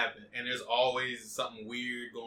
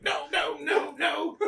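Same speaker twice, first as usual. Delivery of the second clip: mock flustered; 4 dB louder, much slower than usual. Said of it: "He's like".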